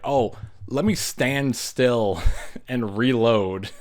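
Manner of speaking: slow, sarcastic put-on voice with a laugh; mocking dumb boyfriend. Vlogger mocks the boyfriend reloading on the stairs, slow and sarcastic. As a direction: drag it out like the character is an idiot. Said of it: "And he's like".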